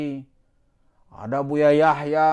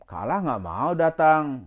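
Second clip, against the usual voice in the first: normal talking voice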